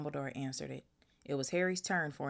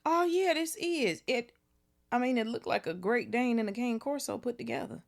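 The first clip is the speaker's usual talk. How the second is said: excited discovery; 8 semitones higher, 6 dB louder than usual. Real, not acted. Finds the dog picture.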